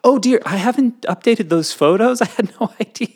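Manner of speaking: high sugary mock apology; hammed-up fake innocence; fake apologetic. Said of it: "And, and apologetic".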